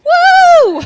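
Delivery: very high, loud cheer; cheering woo. Real, not acted. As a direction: whoop in triumph. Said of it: "I have finished breastfeeding".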